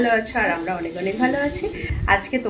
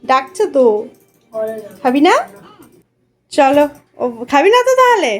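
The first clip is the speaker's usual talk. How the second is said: calling out; 6 semitones higher, 8 dB louder than usual. Real, not acted. Calling son to come eat.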